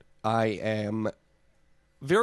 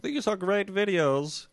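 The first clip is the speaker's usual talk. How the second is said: put-on Australian accent; 8 semitones higher, slower than usual. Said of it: "This is my Australian accent, apparently".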